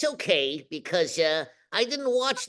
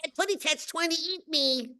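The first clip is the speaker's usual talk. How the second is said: high, small cartoon bird voice; high baby-talk canary; Tweety; 8 semitones higher, 4 dB quieter than usual. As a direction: squeak it like a little canary in peril. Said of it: "you do Tweety, I guess".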